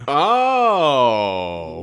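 long swooping "oh" of revelation; astonished. Real, not acted. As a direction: let it soar up, then slide slowly down. Reacting to surprise wedding news.

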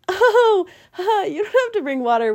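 awkward laughing reply. Retold or imitated. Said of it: "And we're like".

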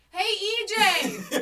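long, high holler across a school; loud calling out. Retold or imitated. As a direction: yell to a friend far away, drawn out. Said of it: "we've always been loud as fuck".

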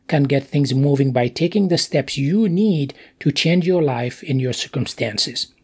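normal talking voice

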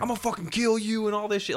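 high-pitched retold threat; high, mock-furious voice; pissed off. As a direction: imitate an angry man without shouting. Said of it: "he's pissed".

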